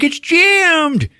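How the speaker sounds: high, loud mock panic; acted panicked shout. Rusty answering her about his jammed shotgun, imitated panicked shout. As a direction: yell back in helpless frustration. Acts man with jammed gun.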